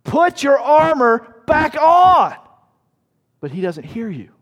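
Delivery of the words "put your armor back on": slow, much higher and louder shout; yelling. Retold or imitated. Soldier shouting at a man who shed his armor, slow loud shout. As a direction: stretch each word across the battlefield. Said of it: "And you're yelling".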